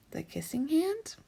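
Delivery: little raccoon asking his mother; wondering child. A narrator's reading. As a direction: ask in small amazed wonder. Chester asks, wondering.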